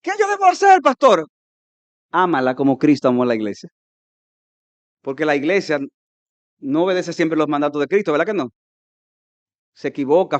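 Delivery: normal talking voice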